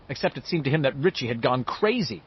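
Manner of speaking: ordinary delivery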